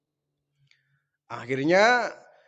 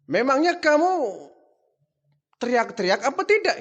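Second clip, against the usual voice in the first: higher, louder pointed question; father grilling his son about his calling out; suspicious father; 7 semitones higher, 3 dB louder than usual. Acted. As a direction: challenge the son's effort.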